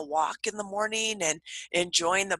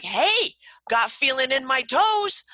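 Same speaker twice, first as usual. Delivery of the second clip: higher, bright and bouncy, almost shouting for joy; cheerful self-talk; 6 semitones higher, 3 dB louder than usual. Retold or imitated. Woman cheering herself after stubbing her toe, upbeat. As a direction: greet the stubbed toe with delight. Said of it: "and I'm like".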